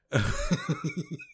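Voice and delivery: short burst of real laughter; quick breathy chuckle; amused giggle. Real, not acted. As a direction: giggle at your own running joke. Laughs at refusing the bit.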